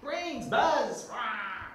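high, loud snarling zombie cry; zombie growl. Acted. Zombie calls out the attack cue to the pilot, snarling. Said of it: "attack and kill me when I call".

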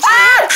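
short, very high shriek; play scream. Pretend. Turtle fleeing the bear, terrified. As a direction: let out a cartoon scream of fright.

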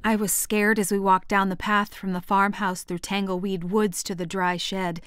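plain reading voice

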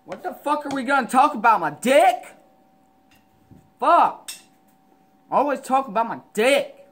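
high, loud rant. Real, not acted. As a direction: yell it out. Loud ranting.

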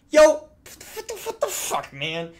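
high-pitched, drawn-out sputter; shocked stammer. Real, not acted. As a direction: squeal it in disbelief. Shocked at what he reads.

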